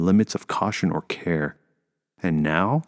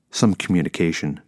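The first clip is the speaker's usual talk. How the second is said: ordinary delivery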